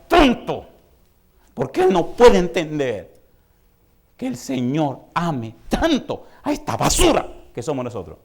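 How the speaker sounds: slow, high, loud pleading as God; voicing God in a loud pleading sermon. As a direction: beg like God pleading to save. Preaching at full force.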